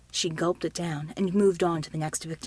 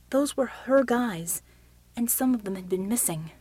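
usual speaking voice

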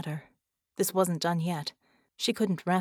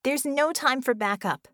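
high, loud barked order; barking; 8 semitones higher, 6 dB louder than usual. Acted. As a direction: snap it out sharply. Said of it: "Bella barked".

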